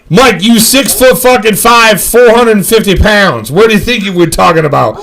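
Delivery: loud, high-pitched incredulous ribbing; exasperated rant. Retold or imitated. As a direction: tell him off loudly, half laughing. Berating Mike over the truck bed.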